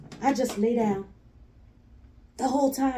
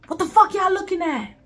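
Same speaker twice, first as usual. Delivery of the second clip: high-pitched mock challenge to a crowd; confrontational; 7 semitones higher than usual. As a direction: imitate someone picking a fight, voice raised high. Same confrontation retold.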